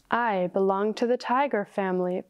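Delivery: ordinary delivery, nothing marked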